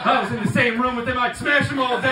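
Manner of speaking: ordinary talk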